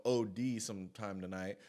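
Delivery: ordinary delivery, nothing marked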